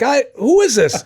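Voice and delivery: high, loud, baffled answer on the phone; confused dad. Retold or imitated. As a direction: sound thrown by a stranger's call, voice jumping up.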